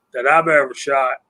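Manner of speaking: usual speaking voice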